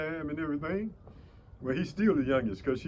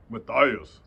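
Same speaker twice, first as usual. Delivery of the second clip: low, louder on the name; dropped voice; 5 semitones lower, 7 dB louder than usual. Real, not acted. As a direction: let the name drop deep and firm. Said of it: "I drop my voice when I say that name".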